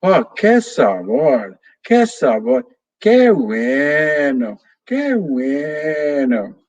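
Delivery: slow, stretched parrot chant; parrot imitating grandma. Parrot Pepe copies the grandmother's praise, very slow drawn-out parrot chant. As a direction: savour it like a parrot copying grandma. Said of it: "And Pepe says".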